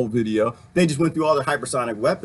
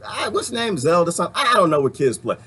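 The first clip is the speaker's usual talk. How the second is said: ordinary delivery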